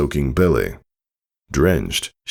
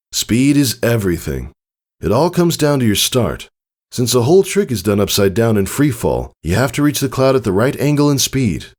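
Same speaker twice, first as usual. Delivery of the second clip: a lightly voiced father pegasus, earnest; Tycho teaching; 6 semitones higher than usual. Father instructing his daughter, lightly voiced teacher. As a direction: explain the trick steadily, a little higher.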